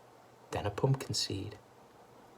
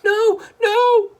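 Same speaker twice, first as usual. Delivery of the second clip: high, loud panicked cry; 13 dB louder than usual. A narrator's reading. The family crying out to stop Grandma, high and loud. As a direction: cry out in alarm. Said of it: "cried the other two".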